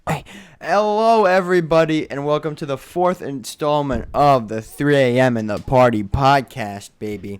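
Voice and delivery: loud, bright announcer opening; podcast host intro. Acted. Host opening the podcast for the fans, announcer style. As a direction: kick off the show like a hype host. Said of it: "messed that intro up".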